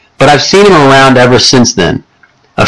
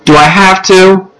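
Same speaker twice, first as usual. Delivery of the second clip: put-on higher, slow kid's grumble; reluctant boy; 8 semitones higher, slower than usual. Jack balking at his mom's request, higher and slower. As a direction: drag it out like a boy who doesn't want to.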